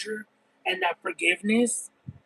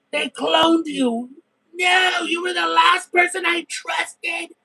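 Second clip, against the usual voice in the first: frantic pleading shout; 7 semitones higher than usual. Retold or imitated. To sister through the window, panicked pleading shout.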